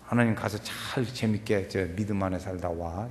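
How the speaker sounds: low, soft send-off; gentle parent. Shown as a demonstration. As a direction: send your child off gently, without nagging. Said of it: "이렇게". (낮고 부드럽게 타이르듯)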